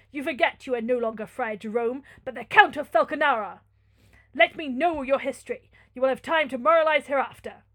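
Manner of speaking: loud, commanding lord; imperious Manfred. Acted. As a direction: order the friar to get to the point.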